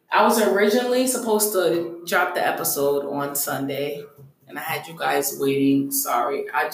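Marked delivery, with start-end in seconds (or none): none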